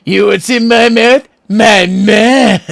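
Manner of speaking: loud, high put-on cartoon voice; hammed-up cartoon impression; imitating a cartoon line. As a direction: ham it up like a Regular Show character. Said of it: "He's like".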